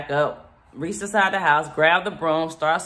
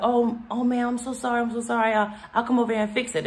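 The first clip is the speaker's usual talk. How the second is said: higher, lightly voiced sweet apology, quickening; apologetic worker; 6 semitones higher, faster than usual. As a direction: hurry to say sorry, politely.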